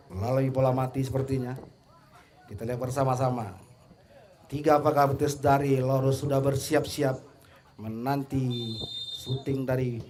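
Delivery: ordinary talk, nothing marked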